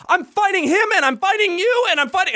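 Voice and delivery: high-pitched, worked-up imitation of rage; mock angry yelling. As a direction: mimic a furious man ranting. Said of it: "now he's yelling".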